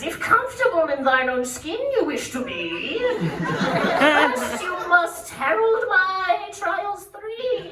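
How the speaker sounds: booming, high-pitched incantation with drawn-out words; bridge troll. Retold or imitated. Troll doctor sets a quest for the patient, grandly booming. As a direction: proclaim it hugely like a quest-giving troll. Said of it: "and he says".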